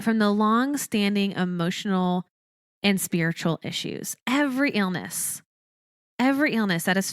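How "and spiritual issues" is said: normal talking voice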